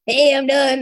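a lightly voiced Ethan, loud and upbeat; Ethan impression. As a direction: announce it like Ethan would, bold and blunt. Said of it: "That's my Ethan impression".

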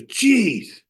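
high, loud exclamation as the friend; retold exasperation. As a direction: blurt it out, exasperated. Ben's reaction in retold exchange.

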